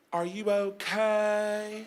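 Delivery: slow, drawn-out high-ending croon; angelic wife. Retold or imitated. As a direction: lean in slowly, stretching every word.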